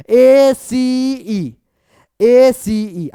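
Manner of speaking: ACE loud, each letter stretched long; drawn-out answer call. Real, not acted. Teacher calling out answer letters.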